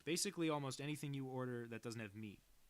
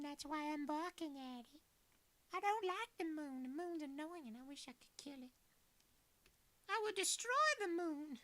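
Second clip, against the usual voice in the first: put-on a tiny old Southern lady grumbling at the moon; little old Southern woman; 5 dB louder than usual. The puppy Proxy, voiced for the brother, a little old Southern woman. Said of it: "he sounded like a little old Southern woman".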